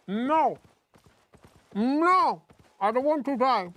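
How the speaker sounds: loud, high panicked pleading. Real, not acted. Streamer about to die in game, loud panic. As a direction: cry out as death comes.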